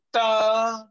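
Ta with a clean tongue release; crisp ta. Shown as a demonstration. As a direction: tap the tongue and let go on Ta. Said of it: "Touch and then let it go".

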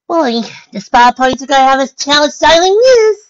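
high, loud bubbly character voice; over-the-top perky pony; excitable party pony. Acted. Party pony blurts cheerful other view.

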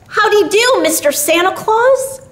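put-on high, eager child's greeting; little boy voice. A narrator's reading. Billy greets Santa Claus, in a high eager child voice.

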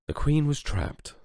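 lively storybook reading voice; story narration. A narrator's reading. Narrator opening the book for young listeners, lively storytelling. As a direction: read it out like a bedtime story.

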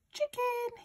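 very high, small calling voice; coaxing. A narrator's reading. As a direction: coax a hen over. Boy coaxing a hen.

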